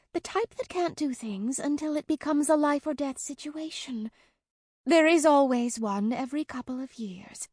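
put-on high, impatient child voice; exasperated young girl. A narrator's reading. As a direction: scold like a bossy little girl. Helena lecturing, exasperated.

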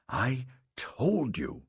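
mildly fume, dragging out each word; fuming, panting. A narrator's reading. Father reaching his disobedient son, panted fuming. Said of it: "he panted, fuming".

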